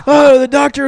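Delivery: high, loud scandalized sister voice, imitated; imitating a prim sister's horrified scolding; mimicking sister. Sister scolding in retold story.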